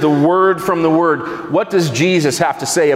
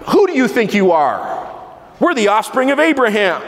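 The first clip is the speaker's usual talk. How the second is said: higher, proud and offended, pushing back; indignant retort; 6 semitones higher than usual. Retold or imitated. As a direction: bristle at the insult like the offended crowd. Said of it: "Indignation, right?".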